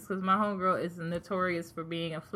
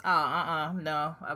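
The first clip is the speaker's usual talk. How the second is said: ordinary delivery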